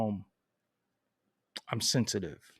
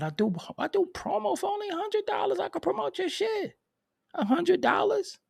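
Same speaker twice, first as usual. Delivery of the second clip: much higher, put-on voice of a pushy promoter; mock hustler pitch. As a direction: hawk cheap promo in a silly high voice. Mocking a promo-selling rapper.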